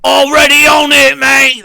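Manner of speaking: shouted Australian stage voice; mock Australian shout. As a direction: yell it like a stage Aussie. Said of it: "that's what Australians sound like".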